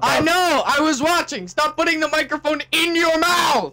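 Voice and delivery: annoyed shout. Real, not acted. Scolding sibling.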